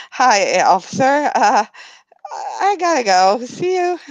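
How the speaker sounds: high, rushed nervous farewell; flustered and eager to get away. Acted. Nervous quick escape.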